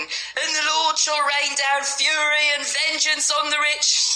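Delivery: high, slow street-preacher boom; mock the shouting preacher on the cross; Angry John preaching. Homeless man mimics the street preacher, high and slow. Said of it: "Angry John standing on the cross shouting".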